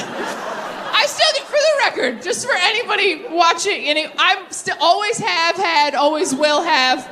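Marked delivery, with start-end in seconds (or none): 0.0-1.4 "I still do" high, slow, breathless with laughter; laughing. Real, not acted. Correcting herself to the audience, "I still do" laughing. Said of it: "I don't know why I said it like that". 1.4-3.8 fix it through giggles; laughing aside. Real, not acted. Comic correcting herself to the audience, laughing. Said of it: "I don't know why I said it like that".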